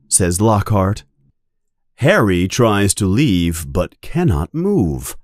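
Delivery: plain reading voice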